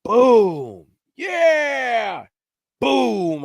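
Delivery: loud, high, drawn-out imitation of gunshots and a holler; imitated shots with a hollered cheer between; booming shots and whoop. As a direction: boom it out with a long triumphant shout. Acts out distant gunshots and holler.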